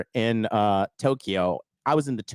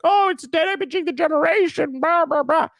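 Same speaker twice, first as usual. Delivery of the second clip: very high mocking whine; mock upset. Imitating men upset over a woman's words, mock whining. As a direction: squeal it as a hammed-up complainer. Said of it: "How are you this upset".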